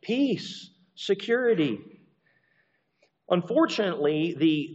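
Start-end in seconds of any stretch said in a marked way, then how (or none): 0.0-1.8 high, mocking "Peace, security"; mocking slogan. Preacher mocking the slogan of the deluded crowd. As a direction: parody the empire's slogan. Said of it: "folks who are saying".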